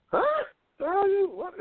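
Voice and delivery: stunned squeak. Retold or imitated. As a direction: yelp it in disbelief. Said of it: "So I'm like".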